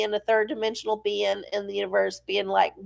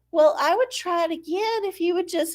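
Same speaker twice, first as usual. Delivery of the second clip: higher, wheedling plea; mock pleading voice; 7 semitones higher, faster than usual. Voicing the thing begging the man, higher and coaxing. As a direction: plead like a hopeful gadget. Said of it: "thing is like".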